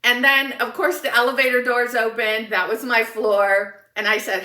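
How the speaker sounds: ordinary talk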